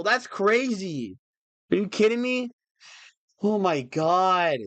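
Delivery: high, slow oh my God; stunned disbelief. Real, not acted. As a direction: let it drag out in awe. Viewer count keeps rising.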